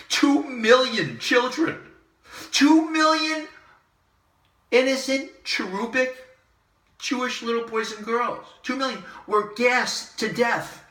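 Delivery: really upset. Real, not acted. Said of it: "I'm getting upset because I'm really upset".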